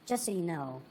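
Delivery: hushed, confiding a heads-up; soft whisper-like. Retold or imitated. Friend softly leaving him.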